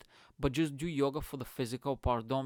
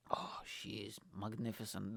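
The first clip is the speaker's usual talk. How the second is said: whisper; 4 semitones lower, 9 dB quieter than usual. Acted. Teasing the last crazy date.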